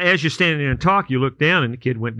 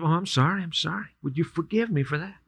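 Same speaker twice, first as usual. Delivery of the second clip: sheepish apology; 6 dB quieter than usual. Acted. Kid apologizes again, second crash.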